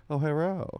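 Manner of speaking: badly faked Chinese accent; mock Chinese accent. Shown as a demonstration. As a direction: put on a half-hearted Chinese accent, rising at the end. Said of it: "do a Chinese accent first".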